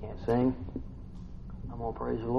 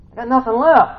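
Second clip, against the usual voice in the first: high, loud cry of exhaustion; raised, pleading shout; desperate outcry; 11 semitones higher, 9 dB louder than usual. Acted. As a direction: throw it out at the end of your rope. Same words cried out.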